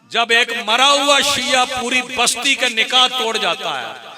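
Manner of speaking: high-pitched, forceful preacher; impassioned preaching. Real, not acted. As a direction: drive the point out to the whole congregation.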